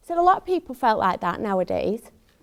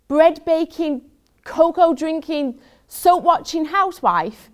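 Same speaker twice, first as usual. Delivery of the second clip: higher, louder outburst of indignant disbelief; exasperated rant; 5 semitones higher, 3 dB louder than usual. Acted. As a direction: rant as if the very idea is absurd. Ranting about a looming proposal.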